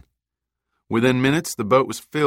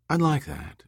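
lightly flirtatious, lifted in pitch; 7 semitones higher than usual. A narrator's reading. Kayla welcoming Austin's invitation, lightly flirtatious. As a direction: accept with a coy, higher lilt. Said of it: "with a flirtatious tilt of her head".

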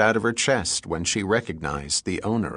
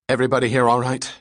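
fast, breathless and louder; panting after the fight; out of breath; 3 semitones higher, 4 dB louder, faster than usual. Acted. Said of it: "catching his breath".

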